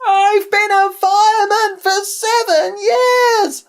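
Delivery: loud, very high, drawn-out holler; slow, piercing cry of alarm; yelling a warning. A narrator's reading. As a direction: yell it at the crowd to drive them away. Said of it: "so he yelled at them".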